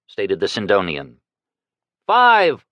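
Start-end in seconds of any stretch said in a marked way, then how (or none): 2.1-2.6 high, loud bid called out; calling out. A narrator's reading. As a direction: call the bid across the room. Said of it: "a voice called out".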